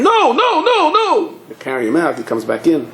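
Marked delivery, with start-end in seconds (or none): 0.0-1.3 high-pitched wailing cries; hammed-up drunken screaming; acted scream. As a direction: scream it repeatedly from the floor, very high. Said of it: "He falls on the floor and screams".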